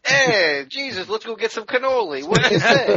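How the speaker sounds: high, loud Brooklyn wise-guy voice; hammy Brooklyn tough guy; Brooklyn accent. Pretend. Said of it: "let's give Judas a Brooklyn accent".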